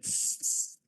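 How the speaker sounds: soft unvoiced tss tss from cheeky monkeys; cheeky monkeys tsking at the peddler. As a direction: hiss back a mocking tss. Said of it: "monkeys ... said".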